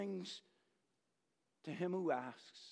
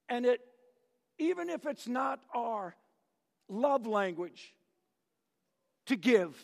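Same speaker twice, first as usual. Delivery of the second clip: higher and louder, urging; fervent exhortation; 5 semitones higher, 4 dB louder than usual. Acted. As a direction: push them to step out. Exhortation at full force.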